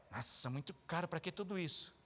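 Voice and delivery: low, soft whiny grumble; grumbling worker. Retold or imitated. Preacher mocks a worker complaining about the factory, low whiny grumble. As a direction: moan like a never-satisfied worker.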